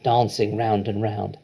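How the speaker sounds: plain reading voice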